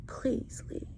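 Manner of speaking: soft breathy whisper, begging; earnest whispered begging; whispered plea. Real, not acted. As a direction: whisper it like a prayer. Begging viewers to leave abusers.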